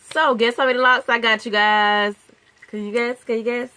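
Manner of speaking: loud, excited reveal. Real, not acted. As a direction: build up then shout the number. Big reveal of lock count.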